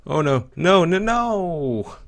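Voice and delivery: high, loud, slow no no no; panicked shout. Real, not acted. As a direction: cry out as you get eaten. Dies in game.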